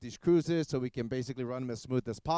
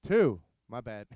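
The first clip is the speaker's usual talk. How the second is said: normal talking voice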